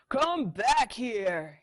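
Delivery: loud slow angry call; honking yell. Acted. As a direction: bellow it after him. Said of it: "he honked".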